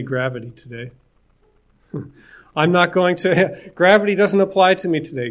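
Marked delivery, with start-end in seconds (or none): 2.6-5.3 louder, higher, playful declaration with a laugh; mock defiance. Joking to listeners about not obeying gravity, mock defiance. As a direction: pretend you can opt out, laughing it off.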